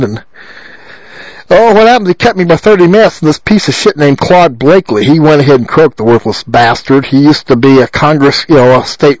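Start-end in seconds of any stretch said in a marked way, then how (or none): none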